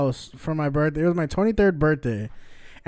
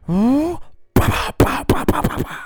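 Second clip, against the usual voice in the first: mock crying; 9 semitones higher than usual. Switching maps while driving, mock crying bah bah. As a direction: fake whiny blubbering bahs. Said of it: "I was just like".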